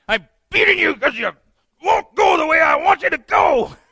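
high, very loud exasperated shout; hammed-up screaming rant at a disobedient animal; furious yelling. Balaam answering his talking donkey, enraged yelling.

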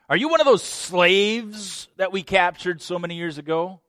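haughty, taunting king voice, high and loud; mocking king. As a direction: sneer down at a captive. King taunts captive Daniel.

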